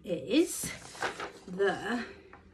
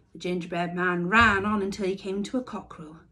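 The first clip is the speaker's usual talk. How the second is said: plain reading voice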